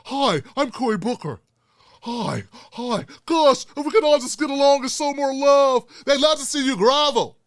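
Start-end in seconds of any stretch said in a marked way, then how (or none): 0.1-5.9 mock Cory Booker. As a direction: play a too-sweet peacemaking senator. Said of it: "not like Cory Booker".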